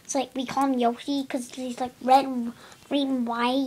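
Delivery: ordinary delivery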